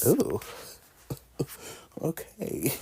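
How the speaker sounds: ooh, then a breathy laughing okay; giggly. Retold or imitated. Reacting to the kitchen scene, giggling. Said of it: "I said".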